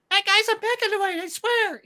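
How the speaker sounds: put-on high fast child voice; little kid voice. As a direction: chatter it like a hurried kid.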